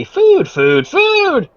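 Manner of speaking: high, squeaky hungry rats; chittering rat voices. Acted. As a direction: squeak it like greedy little rats. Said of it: "chittering voices that say".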